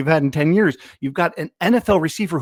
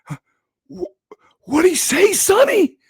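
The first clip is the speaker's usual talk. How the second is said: put-on much higher, louder creaky-old-man voice; 5 dB louder than usual. Old fan at a press conference asks his grandson, cartoon old man voice. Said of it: "And I lean over to him and I say".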